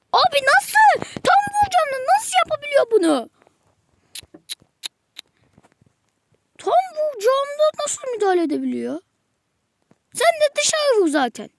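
high, loud, exasperated yelling at the game; real frustrated shouting; furious outburst. Real, not acted. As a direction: rage at the screen, voice up high. Gamer raging at the match.

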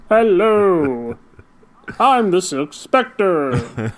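very slow, goofy drawn-out announcement; Seinfeld voice. Retold or imitated. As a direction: stretch every word into comic silliness. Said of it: "in that Seinfeld voice".

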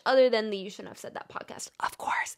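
quick whispered plug; whispered aside. Real, not acted. Aside plugging own podcast.

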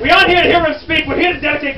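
loud, high mock indignation; exasperated correction. Guide correcting why the crowd is here, mock exasperated. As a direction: correct them with force.

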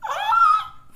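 sudden high shriek, half laugh half cry; a real yelp of pain with a laugh in it. Real, not acted. Peeling a mask off her face, shrieking in pain.

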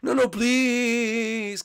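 high, slow whining plea; mock pleading. Blimpy begging Luigi not to leave, high and slow. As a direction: drag out the begging like a sulky ghost.

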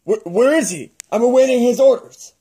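startled eagerness. Acted. Costumed fan thinking Lord Vader has arrived, startled and eager.

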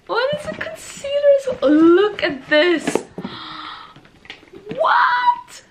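very high, loud cry of surprise; screaming with delighted shock; shocked squeal. Real, not acted. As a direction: shriek in disbelief. Finding concealer in the box.

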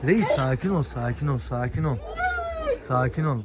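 soft, hushed calming to a barking dog; soothing a dog. Real, not acted. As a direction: settle the dog down gently and quietly.

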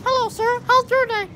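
high Elmo squeak; chirpy puppet greeting to a man. Pretend. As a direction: Elmo impression greeting a shopper. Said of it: "I'm doing an Elmo impression".

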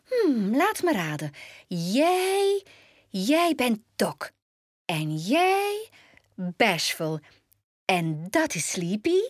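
high, swooping guessing-game voice; exclaiming playfully. A narrator's reading. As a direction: draw out each guess with high, playful lifts. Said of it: "Toen riep ze uit".

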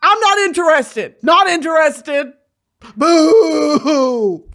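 loud, high, indignant rant; mock heckling. Fan booing [name] off the internet, loud mock outrage. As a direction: heckle him off the stage.

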